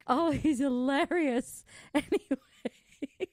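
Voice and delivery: high, slow, drawn out through laughter; laughing. Real, not acted. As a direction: gasp it out while laughing. Cracking up at the dog.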